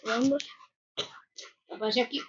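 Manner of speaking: ordinary talk, nothing marked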